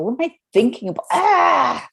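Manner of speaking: rushing, then a high pained cry; mock-anguished wail of overwhelm; stressed outburst. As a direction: spiral into panic and break into a cry. Acting out a stressed mind.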